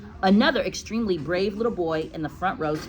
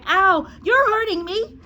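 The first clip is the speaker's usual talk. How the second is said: put-on high, loud child's protest; cried out in pain; 14 semitones higher, 8 dB louder than usual. A narrator's reading. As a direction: wail it as a hurt schoolboy. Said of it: "Eric cried".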